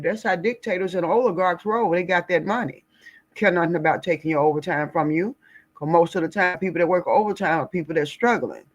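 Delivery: usual speaking voice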